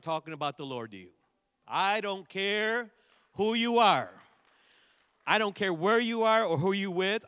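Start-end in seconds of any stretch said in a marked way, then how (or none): 1.7-7.2 rising preacher's cry. Real, not acted. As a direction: hammer it home louder each phrase.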